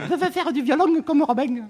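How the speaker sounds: put-on high, lisping little girl voice; slushy lisp. Shown as a demonstration. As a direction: lisp it hard like a young child. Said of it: "Fais-le avec l'accent".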